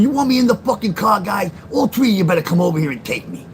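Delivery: loud dare at the men in the car; defiant shout. Retold or imitated. Shouting a dare at three men in suits. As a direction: shout them down, daring them.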